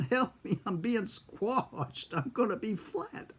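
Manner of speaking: high, slow, stretched-out mock wail; retold screaming. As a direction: whine in exaggerated panic like a trapped kid. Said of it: "I kept screaming".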